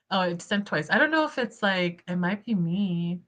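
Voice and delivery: ordinary talk, nothing marked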